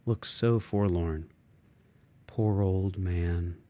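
ordinary delivery

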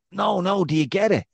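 hammed-up drunk fan hammering the point; insistent fan. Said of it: "So he's very enthusiastic".

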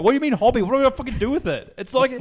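put-on high, indignant kid's outburst; retold angry rant. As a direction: act out an exasperated argument with dad. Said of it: "I'm getting really angry".

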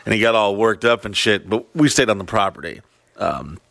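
ordinary delivery

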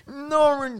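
high-pitched imitated mumble; exaggerated high mumbled protest; mock mumbling. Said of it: "så begynder han at mumle".

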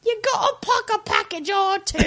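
sing-song the line in a mock London accent. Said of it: "that's just the accent".

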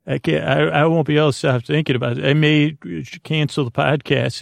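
normal talking voice

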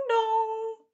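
high sung bell chime; bright high ding-dong chime; doorbell dong. A narrator's reading. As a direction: ring out like a doorbell. Doorbell sound imitated.

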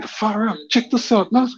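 high, wondering call; amazed. Retold or imitated. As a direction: share the wonder.

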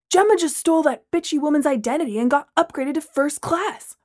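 jealous huffy complaint; huffing. Acted. Braylon huffing jealously to Steve. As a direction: grumble it with jealousy. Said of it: "Braylon huffed".

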